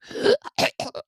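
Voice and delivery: high, breathy whooping cough sound. Acted. Boy on the boarding ramp lets slip a whooping cough. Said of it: "He breathed and coughed".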